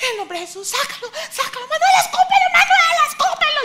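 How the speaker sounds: high, loud frantic shouting, laughing; panicked shouting. Retold or imitated. Mother yelling at her daughter to spit it out, panicked shout. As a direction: scream it in a total panic.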